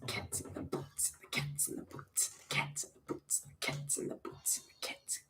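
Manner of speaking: whispered beatbox drum pattern; voiceless percussive mouth drums, very soft. Acted. Showing off as a drummer, whispered beatboxing.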